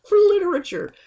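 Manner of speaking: excited squeal. Real, not acted. Squealed punchline.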